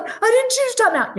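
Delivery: put-on high protesting toddler voice; a little boy insisting he's innocent; child protest. Mother imitating her son protesting timeout, high and indignant. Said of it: "He's like".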